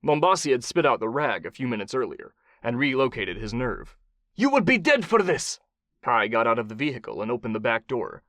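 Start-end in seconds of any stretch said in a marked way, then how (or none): 4.3-5.6 put-on high-pitched, accented threat; angry warlord. Bound warlord threatens his captor, high and angry. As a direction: threaten as an outraged captive warlord.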